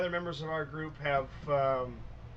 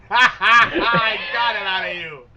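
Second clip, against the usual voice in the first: loud, high laughing crow; real laughing; 4 semitones higher, 11 dB louder, slower than usual. Real, not acted. Game master gloats at the player's outburst, laughing loudly. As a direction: crow it through laughter, stretched out.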